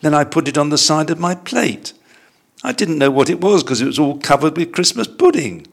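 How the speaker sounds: high-pitched flustered bear explaining; gasped protest. A narrator's reading. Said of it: "gasped Paddington".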